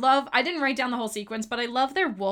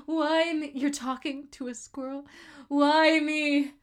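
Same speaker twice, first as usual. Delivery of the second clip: building to a louder, higher whine; whining rising; 4 semitones higher, 4 dB louder, much slower than usual. Retold or imitated. Fan quoting the girl complaining, rising whine. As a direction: whine more each time. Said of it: "she's like".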